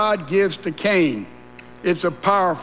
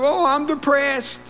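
mock complainer; 7 semitones higher than usual. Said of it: "Somebody says".

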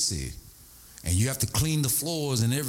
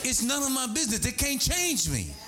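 much higher, slowing, emphatic preacher; climactic preaching; 10 semitones higher than usual. Acted. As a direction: drive the point home to the church. Preaching at full force.

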